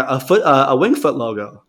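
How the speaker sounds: ordinary talk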